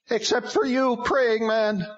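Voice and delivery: high, loud demon voice, mocking; sneering demon. A narrator's reading. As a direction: sneer at the praying man like a demon.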